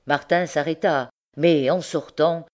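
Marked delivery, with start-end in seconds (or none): none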